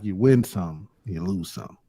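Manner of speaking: a hint of a wise old-timer; old man voice. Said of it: "I tried to go into my old man voice just then".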